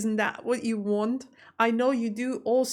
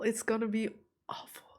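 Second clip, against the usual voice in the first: softer, whispered repeat of "awful"; 5 dB quieter than usual. Real, not acted. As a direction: breathe "It's gonna be awful" to yourself. Repeats her fear, then whispers.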